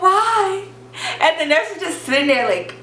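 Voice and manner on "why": whining protest. Retold or imitated. Whiny protest to mom's refusal.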